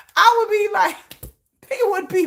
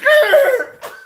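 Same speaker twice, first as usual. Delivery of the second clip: loud laugh; 11 semitones higher, 6 dB louder than usual. Real, not acted. Laughing at the thought of fainting ugly. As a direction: burst of high laughter.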